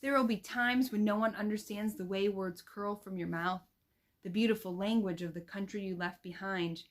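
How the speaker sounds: gentle, expressive picture-book reading; read-aloud to children. Shown as a demonstration. Teacher demo-reads a picture book.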